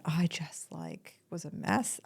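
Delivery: breathless whisper, slow; barely voiced, overcome; a soft whisper as the memory hits. Real, not acted. Recalling breaking down over swan.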